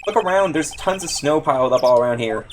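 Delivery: normal talking voice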